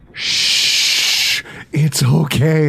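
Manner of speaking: whispered shh into a soothing it's okay; mock hushing. To his wife over the Amazon bill, mock hushing. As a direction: hush her like calming a child.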